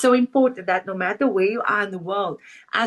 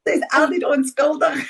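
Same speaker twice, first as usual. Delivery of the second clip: laughing; 5 semitones higher, 3 dB louder than usual. Real, not acted. Friends teasing, laughter heard.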